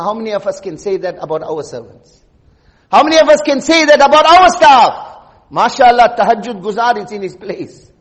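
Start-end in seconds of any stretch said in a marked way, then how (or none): 0.0-2.2 softer, dropped "How many of us can say that about our servants?"; quiet reflective question. Real, not acted. Preacher turning the question on the congregation, quietly. Question then repeated louder. 2.9-5.2 "How many of us can say that about our staff?" higher and louder, stress on "our"; raised repeated question. Real, not acted. Preacher challenging listeners about their staff, raised voice.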